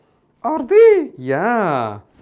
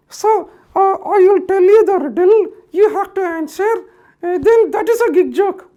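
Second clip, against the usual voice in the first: Mickey puppet voice; 7 dB louder than usual. Acted. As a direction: greet the kids like a bouncy puppet. Puppet Mickey announces a riddle.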